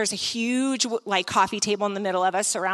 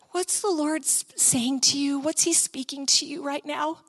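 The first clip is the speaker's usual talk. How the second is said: soft, slightly raised, careful question; gentle coaxing; 3 semitones higher, 4 dB quieter than usual. Retold or imitated. Speaker asks the weeping woman what she hears, gentle coaxing. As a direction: ask softly, as if not to startle her.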